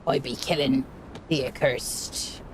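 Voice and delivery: slow, drawn-out pirate talk. Pretend. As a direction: ham it up like an old sea dog. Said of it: "The, the pirate voice is back".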